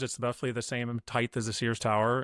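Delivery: ordinary delivery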